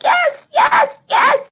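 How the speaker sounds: very high exultant cries; worked up. Acted. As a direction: cry it out, carried away with triumph. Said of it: "I'm getting too worked up".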